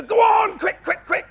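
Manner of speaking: urgent. Shown as a demonstration. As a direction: fire the dog off after the bird. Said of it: "a bit of urgency in our voice".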